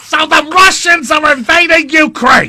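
very high, loud squawking yell; Gilbert Gottfried shout. Pretend. Cohost shouts the news in a Gilbert Gottfried squawk. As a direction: scream it like a grating comedian.

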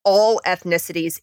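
ordinary delivery

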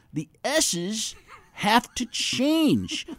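high, slow S's; lisped S sounds in impression; Adam Schefter voice. Shown as a demonstration. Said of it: "to get the sound for Adam Schefter".

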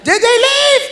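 high mom voice calling out. Retold or imitated. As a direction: mom checking if they left.

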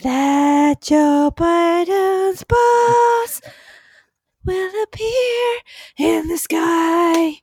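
drawn-out grand proclamation; slow dramatic. Acted. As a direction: stretch every word grandly.

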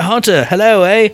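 high, playful Canadian hello; mock Canadian greeting. Waving to Hunter across the border, mock Canadian greeting. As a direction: hail someone across the water with a Canadian lilt. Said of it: "I'll wave and be like".

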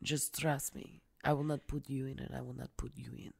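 lightly hammed-up telenovela lover; telenovela imitation. Imitating Logan's pleading trust speech, telenovela style. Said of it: "like a telenovela when he talks".

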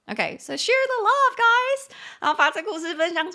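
rally everyone to share the love; squealing share-the-love shout; excited call-out. Real, not acted. Calling viewers to share story.

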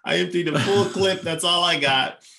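high, loud mock despair; comic despair. Suitor whose best line flopped on a first date, comic despair. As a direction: wail it like your last trick just flopped.

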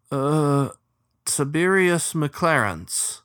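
slow, timid introduction; hesitant. Acted. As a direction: offer the name shyly. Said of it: "he ventured".

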